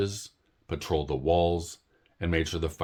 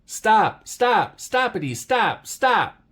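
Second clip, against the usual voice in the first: very high, loud string of stops; a voice crying stop over and over; repeated shouts; 8 dB louder, much slower than usual. Acted. Someone in the forest yelling stop at the noise, high and loud.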